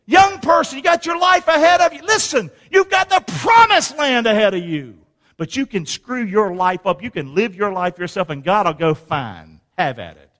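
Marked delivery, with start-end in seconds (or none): 0.0-5.0 high, loud exhortation; preaching shout. Acted. Preacher shouting to young people about their future. 8.9-10.3 dismissive God. Acted. God giving up on them.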